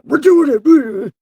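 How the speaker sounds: very high, drawn-out yell; slow, unhinged alter-ego holler; split-personality shout. Acted. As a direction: stretch it into a warped, loud scream. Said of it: "This seriously giving me split personalities".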